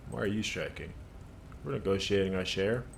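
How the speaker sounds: normal talking voice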